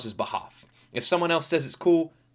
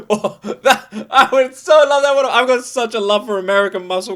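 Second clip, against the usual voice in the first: loud, high laughing longing; real delighted laughter; 6 semitones higher, 6 dB louder than usual. Real, not acted. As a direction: laugh out how badly you want it. Laughing dreaming of muscle car.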